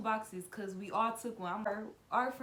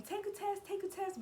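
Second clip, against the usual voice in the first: mock high nagging friends, much higher; 12 semitones higher, 3 dB quieter than usual. Friends pushing her to take a pregnancy test, high mock nagging. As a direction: squeak it like pushy friends.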